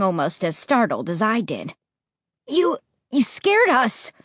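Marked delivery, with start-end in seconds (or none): 2.5-4.1 higher, stammered angry reproach. Acted. Josh scolding Ray for scaring them, mildly angry and higher. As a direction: snap at him for the scare, stumbling on the first word. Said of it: "Josh said angrily".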